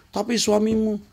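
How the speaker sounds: the collector cutting her off; mock debt collector. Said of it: "Cukup ngomong gitu".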